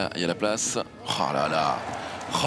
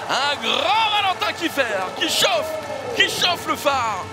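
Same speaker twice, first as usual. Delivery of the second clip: slow, high shouted hype; excited shout; 8 semitones higher, slower than usual. Real, not acted. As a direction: draw out the cheer, higher and slower. Commentator hyping the goalkeeper.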